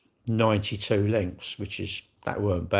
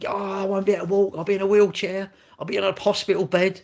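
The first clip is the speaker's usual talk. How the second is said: mock whiny complainer; 7 semitones higher than usual.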